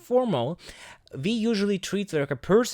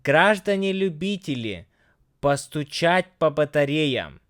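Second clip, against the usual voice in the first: smoother, quicker Russian reading; a little faster. Shown as a demonstration. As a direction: read it through a bit faster. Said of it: "just a little bit faster".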